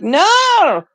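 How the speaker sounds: long, high, loud mock "no"; exasperated wail. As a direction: cry out in hammed-up despair. Retold protest at repeated wrong answer.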